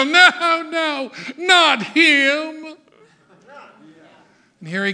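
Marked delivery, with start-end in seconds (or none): 0.0-2.8 high, loud disgusted refusal; Pharisees' protest. Acted. Pharisees seeing who rides the colt, loud high protest. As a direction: spit out the rejection. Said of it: "They look up the hill and they go".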